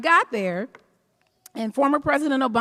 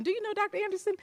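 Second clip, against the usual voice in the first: high, softer mock awe; gushing introduction; 9 semitones higher, 7 dB quieter, much faster than usual. Usher introducing the doctor around, gushing. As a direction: show off a prized guest in a put-on high voice.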